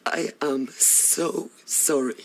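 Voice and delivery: low, slow, breaking to a whisper; remorseful apology. Acted. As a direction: apologise haltingly, full of regret. Wife apologises to betrayed husband.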